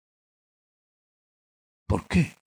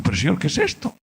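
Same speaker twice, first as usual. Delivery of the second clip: bewildered plea; 6 dB louder than usual. Retold or imitated.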